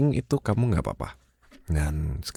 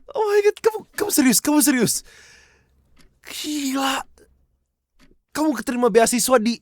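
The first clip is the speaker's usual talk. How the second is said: excited squeal. Acted. Girlfriend hearing her boyfriend won a scholarship, squealing with joy. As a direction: shriek out the happy shock, then gush.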